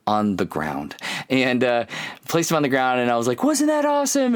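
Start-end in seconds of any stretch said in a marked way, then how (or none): 3.4-4.4 much higher, gleeful question; high-pitched thrilled dad; excited. Retold or imitated. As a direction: squeal with delight at the kid. Dad thrilled after catching son.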